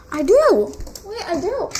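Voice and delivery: loud delighted discovery; excited. Real, not acted. Vlogger finding her toy sword, loud and delighted. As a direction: cheer at finding it.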